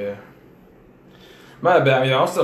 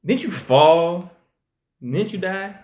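mock shock; 6 semitones higher, much slower than usual. Mocking Jaime's shock at Bran.

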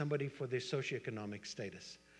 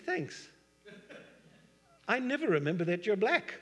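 high, bright mock retort; playful jab at a friend; teasing comeback; 8 semitones higher, 7 dB louder than usual. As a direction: throw it back at him jokingly.

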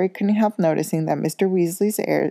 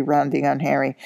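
ordinary delivery, nothing marked

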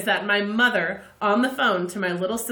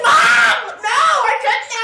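mock protest; 11 dB louder, much slower than usual. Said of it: "And I was like".

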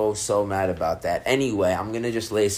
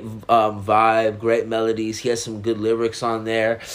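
ordinary talk

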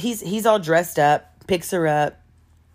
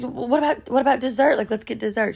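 pleading date; 6 semitones higher, faster than usual. Retold or imitated. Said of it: "He's like".

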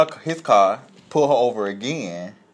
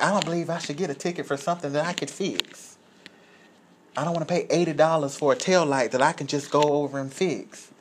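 put-on higher-pitched complaining old lady; imitating indignant old woman; 6 semitones higher, 4 dB quieter than usual. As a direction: protest the ticket as a higher-voiced country woman. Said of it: "That's how she was saying it".

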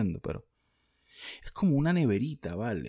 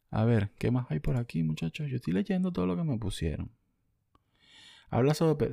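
ordinary delivery

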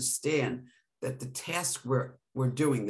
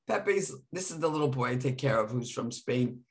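ordinary talk

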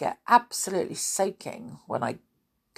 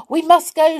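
high, loud urgent shout; 11 semitones higher, 8 dB louder than usual. Acted. Binky urges the friends to the flying chair, loud shout. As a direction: hurry them out, much higher and louder. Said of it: "shouted Binky".

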